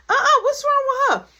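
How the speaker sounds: high shrill-free loud demand, voice way up; mom shouting. Retold or imitated. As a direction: mom yelling across the party to her daughter, loud and high.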